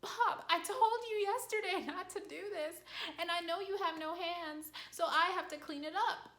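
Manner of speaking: high-pitched, exasperated telling-off of a dog; acted exasperation, pitched well up; frustrated scolding. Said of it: "you're getting mad and frustrated".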